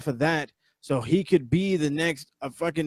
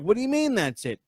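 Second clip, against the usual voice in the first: mock disbelief; 7 semitones higher than usual. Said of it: "And I was like".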